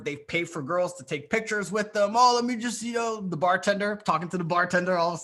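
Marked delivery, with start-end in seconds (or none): 2.2-3.2 higher, mock-eager interruption; pushy attention-seeker. Coach mimicking guys bothering the bartender, mock pushy. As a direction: imitate a guy butting in eagerly.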